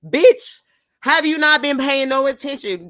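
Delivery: loud, high, fed-up yell; exasperated outburst. Real, not acted. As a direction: blast them for not paying attention. Ranting at clueless travellers.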